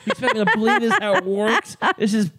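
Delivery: vocal fry, slow and high, mocking the partner; impression of partner ranting at TV; drunk angry impression. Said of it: "do your impression of me watching The West Wing".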